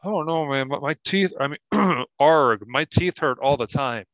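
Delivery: lightly voiced Kentucky pirate; Kentucky pirate accent. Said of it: "that weird accent?".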